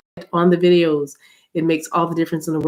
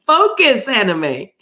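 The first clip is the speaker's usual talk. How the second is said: self-scolding; 6 semitones higher, 4 dB louder, slower than usual. Real, not acted. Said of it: "scolding herself to focus".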